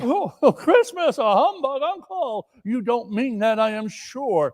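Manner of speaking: high, coaxing disbelief; pleading nephew. Acted. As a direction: gently plead with Uncle.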